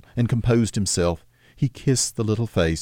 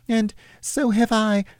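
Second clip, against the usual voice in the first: put-on Peter's boyish voice; 14 semitones higher than usual. Peter answers his mother, lightly voiced boy.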